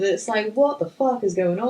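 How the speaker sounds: usual speaking voice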